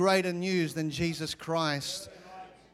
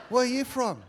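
higher-pitched imitation of a chatty bloke; curious stranger; 8 semitones higher, 4 dB louder than usual. Sauna stranger asking about the accent, mimicked curiosity. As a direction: mimic a nosy stranger's question.

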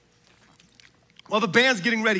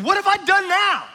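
high-pitched, acted exasperation; retold protest, pitched up; exasperated protest; 6 semitones higher, 3 dB louder than usual. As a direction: throw it back as a wronged younger brother. David protests brother's accusation.

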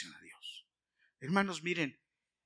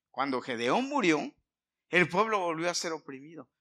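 raised, emphatic sermon voice; loud preacher's storytelling; impassioned preaching; 5 semitones higher, 7 dB louder than usual. Acted. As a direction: drive the story home to the congregation. Preaching at full force.

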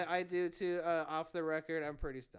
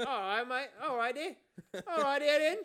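hammed-up Cockney, climbing higher and louder; Cockney accent; 6 semitones higher, 5 dB louder than usual. Host drifts from Australian while riffing, mock Cockney accent. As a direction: build a silly British Cockney greeting. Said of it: "Cockney as shit".

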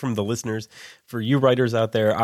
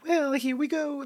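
much higher, put-on woman's voice; a hammed-up female voice for an audiobook character; mock female character voice. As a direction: play a lady character, pitched way up. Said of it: "if there's a female character".

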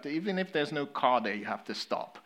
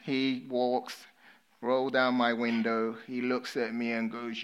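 ordinary delivery

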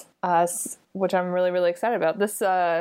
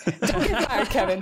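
laughing, teasing warning; laughing tease; 3 semitones higher than usual. Acted. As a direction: tease him through a laugh.

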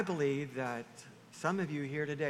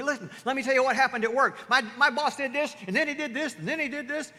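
high, loud, quick-fire complaint; worked-up venting; 8 semitones higher, 7 dB louder, faster than usual. Acted. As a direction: act out griping about the boss. Acts out venting about boss.

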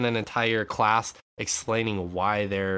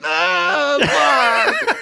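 high, loud comic wail; mock wail; 8 dB louder than usual. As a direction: imitate bursting into tears, loud and high. Retold tearful reaction to praise.

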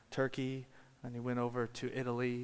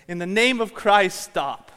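loud, high cry to stop; retold yell; 7 semitones higher, 14 dB louder than usual. Monk yells from his seat at the gladiators, yelling. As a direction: shout it out over a crowd. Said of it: "stands up in the seat and he yells".

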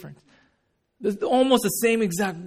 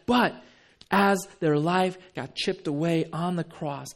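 ordinary talk

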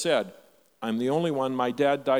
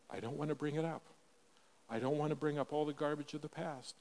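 much softer, hesitant words; reluctant hush; 11 dB quieter, faster than usual. Retold or imitated. Son on the phone to his father, shying away from old hurts, very soft. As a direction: pull back and say it almost under the breath. Reluctant quiet aside to dad.

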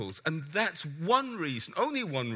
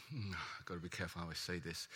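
quiet aside; 11 semitones lower, 15 dB quieter, faster than usual. Real, not acted. Preacher weighing his words.

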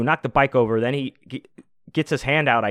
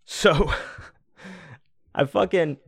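laughing; 7 semitones higher, slower than usual. Real, not acted. Laughing at the scene.

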